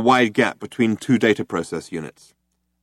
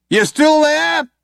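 loud, high, slow call; 7 dB louder, slower than usual. A narrator's reading. Cop calling to the fugitives after the barrage, loud. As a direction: call across the smoke. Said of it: "called one of the cops".

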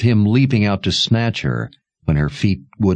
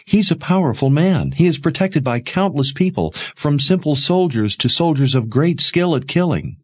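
higher-pitched urgent plea; pleading; 8 semitones higher than usual. A narrator's reading. Mother pleading with Sebastian about their powerful enemy, pleading. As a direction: plead helplessness, pitch held high. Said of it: "not moved by her plea".

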